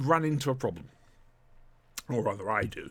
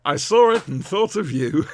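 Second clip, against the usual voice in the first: laughing; 5 semitones higher, 8 dB louder than usual. Real, not acted. Joking thanks, laughing through.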